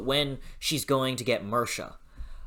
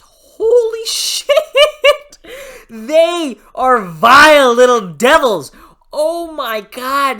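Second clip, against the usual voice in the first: much higher and louder, slowed, laughing through it; laughing outburst; 12 semitones higher, 14 dB louder, slower than usual. Real, not acted. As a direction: cackle in disbelief at the cruelty. Laughing outburst about fairies.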